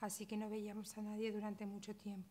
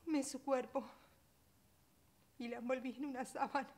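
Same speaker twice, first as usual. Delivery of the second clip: tearful; 4 semitones higher, 4 dB louder than usual. Acted. Recalls wrapping her dead baby.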